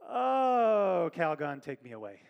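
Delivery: hammed-up weary cry, loud, then sinking; a sermon joke pleading like the old bath ad; mock ad plea. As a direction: melodramatic "Calgon, take me away" plea. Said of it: "Jesus even goes".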